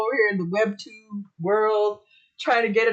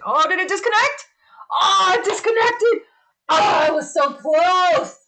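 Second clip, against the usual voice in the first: high, louder cry of dismay; exasperated outburst; 6 semitones higher, 6 dB louder than usual. Real, not acted. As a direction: groan loudly at the lost connection. Stream setup disconnects.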